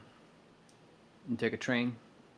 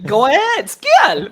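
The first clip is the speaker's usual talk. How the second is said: egging on; 11 semitones higher, 12 dB louder, much slower than usual. Acted. Acting out egging someone on.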